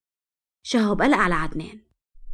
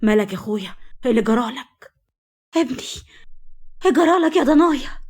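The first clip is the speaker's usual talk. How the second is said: mildly alarmed character line, rising in pitch; 4 semitones higher than usual. A narrator's reading. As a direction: read it as worried relatives crying out. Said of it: "وقال برعب".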